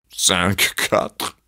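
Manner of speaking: murmur with sobs. Acted. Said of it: "murmura Zeus, des sanglots dans la voix".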